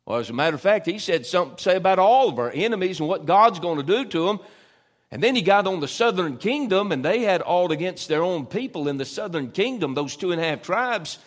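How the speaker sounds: full-voiced pulpit build-up; rousing preaching. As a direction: preach it high and loud, building fire.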